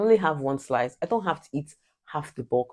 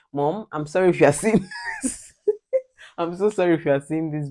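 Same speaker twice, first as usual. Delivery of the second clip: laughing apology; 3 semitones higher, 4 dB louder than usual. Real, not acted.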